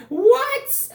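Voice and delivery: squeaky high shocked what; a disbelieving yelp of a question. Retold or imitated. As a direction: caught off guard when she asks him to join her, high and disbelieving. Stunned at her invitation.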